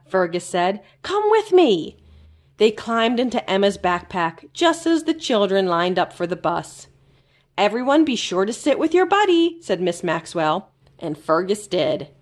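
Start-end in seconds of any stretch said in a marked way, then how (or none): 1.0-2.0 high, bright invitation; Fergus inviting. A narrator's reading. 7.6-9.6 higher, brisk teacher call; teacher instructing children at the bus; Miss Maxwell the teacher. A narrator's reading.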